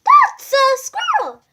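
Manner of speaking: high, loud and slow insistence; shouting. A narrator's reading. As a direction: insist loudly on what the painting shows. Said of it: "the others shouted".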